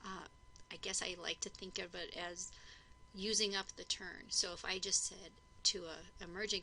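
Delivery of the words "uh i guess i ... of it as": ordinary talk, nothing marked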